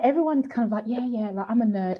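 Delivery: ordinary talk, nothing marked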